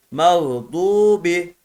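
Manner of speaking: "mağdubi" slowly, with a long held u; slow "mağdubi", lingering on the u; lengthened u vowel. Shown as a demonstration. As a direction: stretch the "du" in "mağdubi". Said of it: "Vav burada uzattı".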